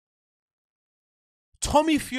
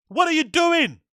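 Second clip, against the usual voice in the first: exasperated shout; 6 semitones higher than usual. Real, not acted. Ranting at the fight, exasperated shout. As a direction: yell it at the screen in disbelief.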